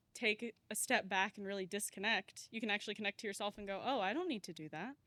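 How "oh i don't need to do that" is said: ordinary delivery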